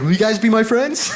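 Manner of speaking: high, hopeful pleading; needy plea. Retold or imitated. Comic begging audience for friendship, high needy plea. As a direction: ask like a lonely kid.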